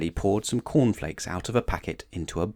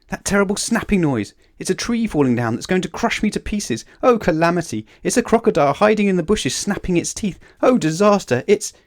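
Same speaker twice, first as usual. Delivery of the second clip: high, loud, escalating hysteria; hammed-up terror, cut off abruptly; panicked shrieking; 11 semitones higher, 6 dB louder than usual. A narrator's reading. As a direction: leap from one imagined disaster to a worse one. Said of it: "he shrieked".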